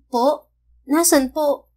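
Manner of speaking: put-on startled little girl; girl asking where her father is; confused child. A narrator's reading.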